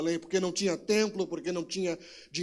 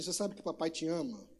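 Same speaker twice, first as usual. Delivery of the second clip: softer, lower gentle question; tender father; 5 semitones lower, 7 dB quieter than usual. Retold or imitated. Father softly reassuring his daughter after punishing her. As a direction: ask it gently, daughter on your lap.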